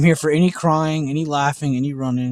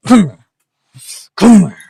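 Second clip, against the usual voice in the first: high, loud come-here call to the dog; 7 semitones higher, 13 dB louder than usual. Real, not acted. Owner calling the dog over, a loud high call.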